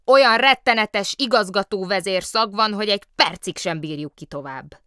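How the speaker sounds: loud retort, starting high; loud mock indignation. A narrator's reading. Bear throwing the director's insult back, loudly indignant. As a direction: declaim it back at him.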